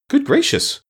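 very high-pitched burst of surprise; astonished exclamation. A narrator's reading. Scarecrow hearing the Emperor is nickel-plated, astonished. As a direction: exclaim it with a big leap in pitch. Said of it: "the Scarecrow exclaimed at hearing this".

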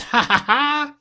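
high, ringing acted ha-ha-ha; splendid laugh. A narrator's reading. Scrooge long out of practice, splendid hearty laugh. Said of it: "it was a splendid laugh".

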